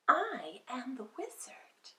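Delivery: soft breathy fairy whisper; gentle whisper. Acted. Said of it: "she said gently".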